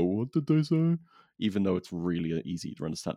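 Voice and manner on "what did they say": high-pitched mock confusion; puzzled, pitched-up put-on voice; baffled viewer. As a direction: imitate a viewer who can't follow the accent. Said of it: "so that people don't go".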